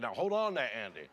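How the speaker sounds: blustery cartoon rooster priest, lightly voiced; a hint of a Southern cartoon rooster; lightly blustering priest, pulling someone up short; Foghorn Leghorn. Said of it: "was that Foghorn Leghorn in the role".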